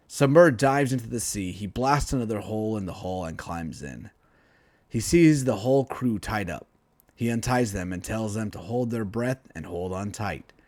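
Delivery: plain reading voice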